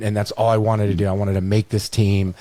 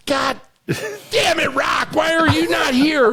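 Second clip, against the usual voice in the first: yelling coach; slower than usual. Retold or imitated. Coach Ray screaming at Rock in practice, high imitated yell. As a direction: bark at Rock like a furious coach.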